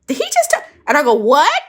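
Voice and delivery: very high shocked cry; shocked disbelief. Retold or imitated. Hearing she goes to jail, shocked squeal.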